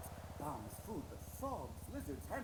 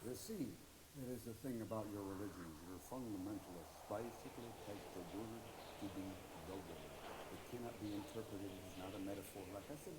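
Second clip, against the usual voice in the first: grave Native elder; 12 semitones lower, 12 dB quieter than usual. Acted. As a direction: lecture with deep solemn calm. Casino Native voice lecturing.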